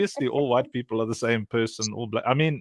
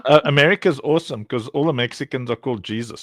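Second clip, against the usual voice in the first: usual speaking voice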